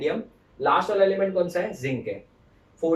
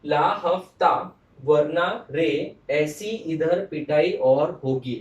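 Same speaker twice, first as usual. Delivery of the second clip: slow, louder, each word spaced out; slow mnemonic recital; 4 dB louder, much slower than usual. Shown as a demonstration. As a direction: drill it slowly for the class. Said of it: "I'll repeat".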